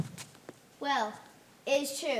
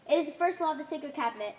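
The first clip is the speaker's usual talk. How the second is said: usual speaking voice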